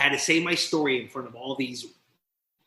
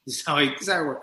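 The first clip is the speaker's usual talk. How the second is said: usual speaking voice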